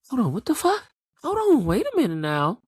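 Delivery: high-pitched startled double take; imitated incredulous outburst; acted disbelief. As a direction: exclaim it high, reacting to a twist. Viewer's reaction to the twist.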